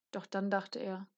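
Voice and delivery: plain reading voice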